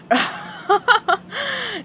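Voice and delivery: high chuckle; friendly amused laugh; doctor's laugh. Acted. Doctor laughs at SpongeBob, high chuckle. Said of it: "The doctor laughed".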